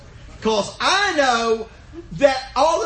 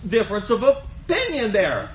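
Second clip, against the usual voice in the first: ordinary talk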